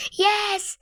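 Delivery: high, loud burst of joy; triumphant cheer. Real, not acted. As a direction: shout it in victory on finding the lost pet. Finds the missing bird. (উল্লাসে চেঁচিয়ে)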